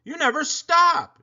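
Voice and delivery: high and loud; mother yelling in frustration. Acted. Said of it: "yelled Mother".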